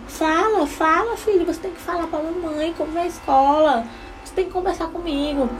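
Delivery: mock pushy mother urging child to speak; pushy pleading mom. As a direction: nag him to talk, urging. Pressing son to talk.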